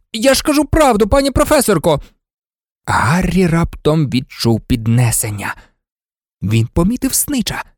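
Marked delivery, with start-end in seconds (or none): 0.1-2.0 high-pitched plea to the professor; worked-up self-justifying commentator; defensive protest. Acted. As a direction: protest innocence to a teacher.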